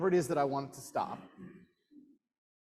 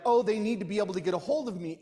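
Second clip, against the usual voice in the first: self-important; 4 semitones higher, 4 dB louder, faster than usual. Retold or imitated. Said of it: "in your mind you're like".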